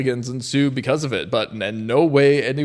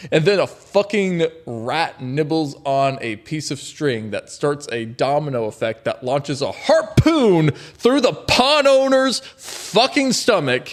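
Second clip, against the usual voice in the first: ordinary delivery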